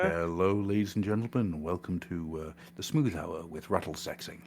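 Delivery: low, soft, crooning lounge host voice; smooth jazz radio host. Pretend. Host mock-introduces his jazz band, soft smooth crooning. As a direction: purr it like a late-night jazz DJ.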